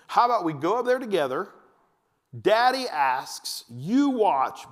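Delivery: emphatic acted dad bargaining; coaxing dad. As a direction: spell out the deal for a small child. Dad bargaining slowly with child.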